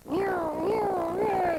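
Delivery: a high, loud vocal police siren wail; siren imitation. Guest imitates the police siren that pulled them over, high and loud.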